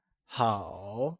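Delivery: 好 very drawn out, dipping low then rising; slow third-tone model of 好; falling-rising tone. Shown as a demonstration. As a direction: stretch 好 softly down and back up. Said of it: "It is said with a falling".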